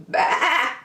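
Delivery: high mock bleat; hammed-up baa; sheep bleat. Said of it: "the sheep said".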